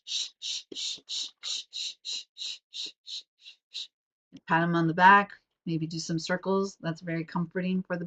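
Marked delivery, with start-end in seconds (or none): 0.0-3.9 quick repeated whispered "sh-" in a bouncing rhythm; rhythmic shushing. Shown as a demonstration. Showing parents the bouncing shush, rhythmic whisper. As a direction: pulse "sh-" softly with each bounce, fading. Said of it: "and you go sh".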